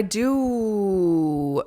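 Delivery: slow, drawn-out effect voice; playful whooshy delivery; sound effect voice. Real, not acted. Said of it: "cool sound effect I just did".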